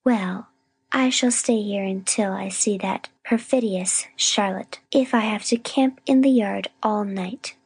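slow, stubbornly indignant declaration; sniffy determination. A narrator's reading. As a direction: dig in your heels, drawing the words out. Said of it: "sniffed Miss Rosetta".

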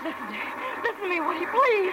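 high, breaking pleading; desperate plea. Acted. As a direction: plead for mercy. Begging for her life.

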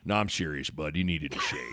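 low, soft, deadly earnest coach; Coach Altman. Retold or imitated. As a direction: imitate a coach insisting in deadpan. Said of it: "he was dead serious".

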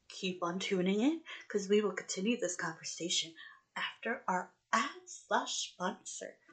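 very soft, whispery aside to listeners; hushed ad-break announcement. Real, not acted. Podcast host telling listeners the ad is coming, hushed.

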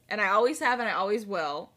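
usual speaking voice

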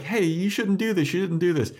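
high pleading call after a departing friend. Retold or imitated. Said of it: "Xander and Buffy try to, like, chase after Willow".

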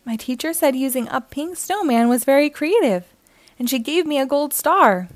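excited. Acted. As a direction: share great news with lifted, eager energy. Boy brings home great news.

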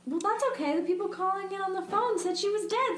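high-pitched sarcastic shrug; mock dismissive. Co-host mockingly excusing the operator, sarcastic. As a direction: play it breezily unconcerned, voice pitched well up.

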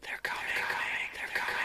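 haunting unvoiced whisper. Bird repeats its warning to the owner, haunting whisper. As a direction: whisper it over and over, spookily. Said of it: "he repeated in a haunting voice".

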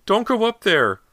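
loud high pleading scream. A narrator's reading. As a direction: scream to stop him. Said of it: "Missus Fraser screamed after him".

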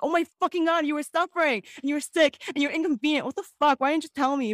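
scolding relatives for hiding sickness, exasperated; exasperated outburst. Retold or imitated. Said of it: "I'm like".

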